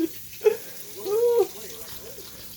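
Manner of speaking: high-pitched giggling fit; hysterical laugh. Real, not acted. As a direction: lose it completely laughing. Stoned cook laughing at his show.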